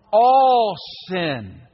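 all sin punched high and loud; emphatic stress. Real, not acted. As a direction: hit all sin hard. Preaching emphasis.

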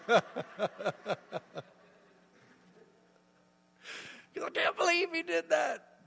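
very high hammed-up whine; mock disbelief. Embarrassed colleague complains about the book, mock disbelief. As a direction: squeal it in comic disbelief.

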